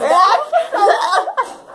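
pained laugh. Real, not acted. Pepper burning.

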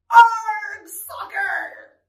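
high, loud first word, rowdy cheer; yelling. Acted. Reader leads listeners in the crew's soccer cry, yelling. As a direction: yell it for listeners to copy. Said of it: "the crew yelled".

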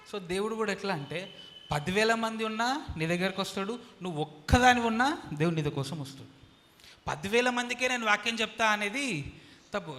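loud, high sermon voice; preaching at full force. Acted. Pastor preaching to the church, loud and high. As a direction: drive the point home loudly.

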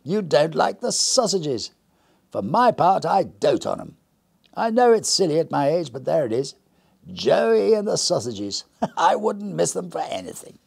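bright, high, hearty stranger; jolly visitor voice. Acted.

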